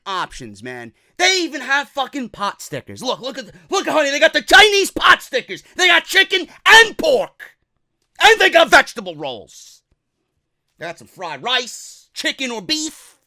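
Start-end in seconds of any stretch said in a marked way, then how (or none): 3.7-9.8 much louder, higher excitement; excited about the buffet food; excited Kurt. Acted. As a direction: gush over pot stickers.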